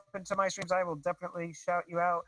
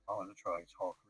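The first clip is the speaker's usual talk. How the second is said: very low voice; 10 semitones lower than usual. Shown as a demonstration. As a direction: drop the voice as low as possible. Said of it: "here, I'm gonna try".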